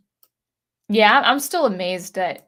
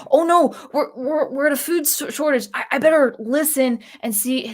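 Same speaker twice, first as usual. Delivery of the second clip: higher, louder mock panicked viewer; mock alarm, pitched up and louder; 5 semitones higher, 5 dB louder than usual. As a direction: ham up a frightened news watcher, higher and louder. Mocking panicked news viewer.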